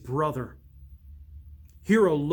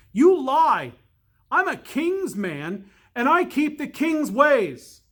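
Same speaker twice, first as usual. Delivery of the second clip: much higher, louder defiant shout; shouted back; 10 semitones higher, 7 dB louder than usual. Acted. Littlechild shouting back at the enchanter. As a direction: yell it back with the hatchet raised. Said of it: "shouted back".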